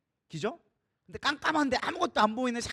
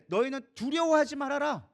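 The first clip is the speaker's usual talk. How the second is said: raised, higher commanding call; firm command; 5 semitones higher, 4 dB louder than usual. Acted. Moses telling his frightened people not to fear, firm command. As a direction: declare it to a whole crowd.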